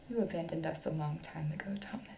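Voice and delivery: soft, low, grieving whisper; hurt whisper. Acted. Wife accuses husband of leaving, hurt whisper. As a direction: barely get the words out.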